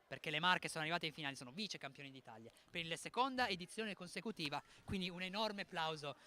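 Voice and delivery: ordinary talk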